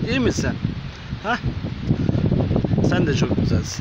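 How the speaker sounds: loud, high coaxing voice for animals; high excited pet talk. Acted. Talking to street cats, loud high excited coaxing. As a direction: call them in eagerly, loud and high.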